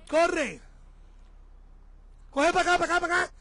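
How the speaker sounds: calling out. Real, not acted. Vlogger calling wife out of the traffic, loud urgent calls. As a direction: wave her over by voice, urgently. Directing wife away from cars.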